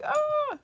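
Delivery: very high, drawn-out "oh"; touched aww. Acted. Teacher seeing students' family pictures, touched high coo.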